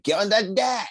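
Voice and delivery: high-pitched impression of his greeting; imitating the host. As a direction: call it out high, in his manner. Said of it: "that's the way he talks".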